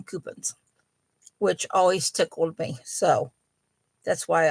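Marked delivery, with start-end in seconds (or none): 0.0-0.6 "coupons" said as KOO-puns; sister-in-law's pronunciation. Retold or imitated. Host quoting her sister-in-law's word, "coupons" as KOO-puns. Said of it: "my sister-in-law always called them coupons".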